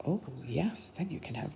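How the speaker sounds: quiet, gentle agreeing; hushed agreement; soft aside. Real, not acted.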